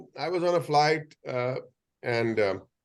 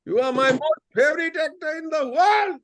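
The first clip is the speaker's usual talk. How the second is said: much higher, loud, overjoyed fan; excited fan shout; 4 dB louder than usual. Retold or imitated. Passenger spots the actor and shouts his love, high and loud. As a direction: yell it out like a starstruck passenger. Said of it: "started shouting his head".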